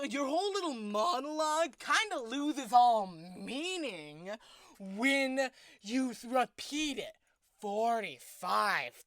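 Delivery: fed-up rant. Acted. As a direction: tell him off, loud and annoyed. Continued rant at the captor.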